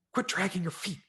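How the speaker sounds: put-on sharp, high, loud bark at a child; barked order. A narrator's reading. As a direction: snap at the dawdling kid to move. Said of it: "she barked".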